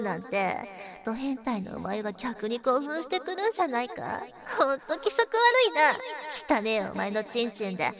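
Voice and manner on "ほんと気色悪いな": slow, higher, louder sneer of disgust; drawn-out disgust. Acted. Scolding the listener, slow disgusted sneer. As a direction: stretch it out, revolted.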